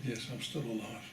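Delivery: soft, muted retold answer; subdued reply. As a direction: answer very quietly, letting it drop. Retold reply, more than he could take.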